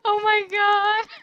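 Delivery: high, slow words through laughter; cracking up helplessly; laughing hard. Real, not acted. Laughing at sister's breathing.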